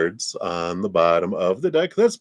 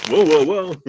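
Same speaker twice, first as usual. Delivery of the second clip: higher, louder startled whoas; surprised whoa; 10 semitones higher, 8 dB louder than usual. Real, not acted. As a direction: react to a surprise card. Surprised by the cards.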